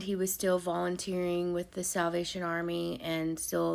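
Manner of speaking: ordinary talk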